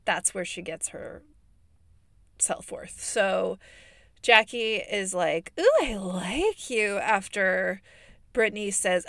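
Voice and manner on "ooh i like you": slow, drawn-out swooping approval; mock gushing approval. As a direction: imitate someone hammily taken with a newcomer. Imitates Jackie warming to Brittany.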